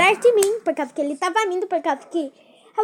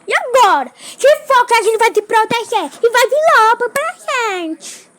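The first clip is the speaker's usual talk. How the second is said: panicked yelling; 5 semitones higher, 7 dB louder than usual. Real, not acted. Panic, wolves coming.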